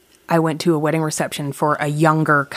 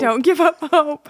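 lightly voiced old man, high pitch; grandpa voice; 11 semitones higher than usual. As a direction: echo the grandpa's line playfully. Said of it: "hearing aid grandpa voice".

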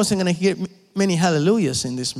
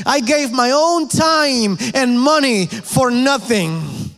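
higher, slow, aggrieved complaint; indignant Naaman; 6 semitones higher, 3 dB louder than usual. Acted. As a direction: drag out the grievance, offended and proud. Preacher voices Naaman's indignant thoughts.

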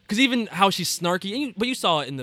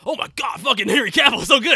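high, louder mock fanboy rave; mock gushing; 8 semitones higher, 7 dB louder than usual. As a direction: gush over the actor in exaggerated awe. Mock gushing fan, denying he felt so.

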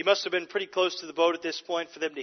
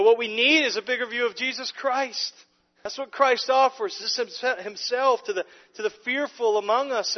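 louder, higher, driving sermon voice; impassioned preaching; 4 semitones higher, 6 dB louder than usual. Acted. Preacher urges the congregation toward a bigger view of Christ, impassioned. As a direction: press the point home to the pews.

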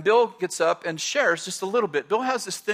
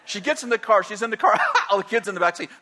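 ordinary talk, nothing marked

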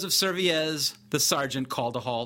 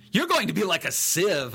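lightly jeering joke, pitched up; joking; 4 semitones higher, 3 dB louder than usual. A narrator's reading. Soldier outdoing the others in tormenting prisoner, joking. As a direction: crack a mocking joke at his expense. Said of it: "another joked".

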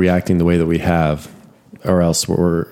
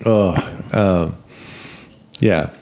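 laughing; slower than usual. Real, not acted. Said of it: "you really tickled yourself there".

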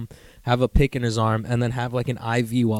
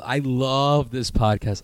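ordinary talk, nothing marked

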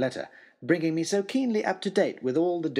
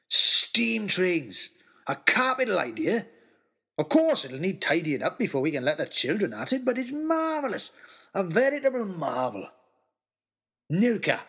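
jolly big man; 3 semitones higher than usual. Acted. Giant's delighted speech.

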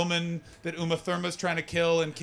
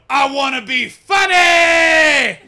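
slow, high, full-volume bellow; mock drunken heckler; 10 semitones higher, 14 dB louder, much slower than usual. Comedian imitates the Geordie demanding attention on stage, high drawn-out shout. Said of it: "and he's going".

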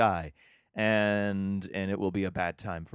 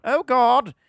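much higher, louder exclaim; mock cry; 11 dB louder than usual. Hikers on God's mountain crying out, loud mock yelp.

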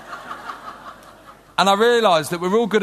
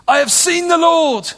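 higher and louder, bursting with news; joyful announcement; 10 semitones higher, 5 dB louder than usual. Acted. Reading Mary's words to the disciples, with an excited high cry. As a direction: proclaim it like the best news ever. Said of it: "Mary Magdalene went to the disciples with the news".

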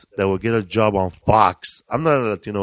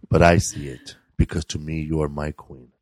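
romantic poem recital; 5 semitones lower than usual. Shown as a demonstration. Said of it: "mine was".

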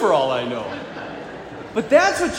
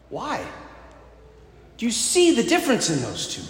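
usual speaking voice

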